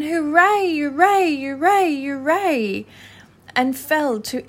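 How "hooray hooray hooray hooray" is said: high, loud run of cheers; jubilant cry. A narrator's reading. Rat celebrating his find, repeated jubilant cries. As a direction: whoop it over and over, each cheer falling. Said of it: "Suddenly the rat cried".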